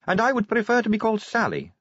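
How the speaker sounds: high-pitched vampire recruit; Sally. A narrator's reading. Sally corrects Vimes.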